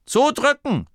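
very high, loud command; Befehl. A narrator's reading. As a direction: order the crew to push. Inventor orders crew to push. (laut und sehr hoch kommandiert)

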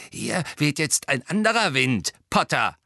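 spiteful, triumphant warning; gloating caretaker. Acted. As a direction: relish the threat in a put-on voice. Gleeful caretaker threatens Harry.

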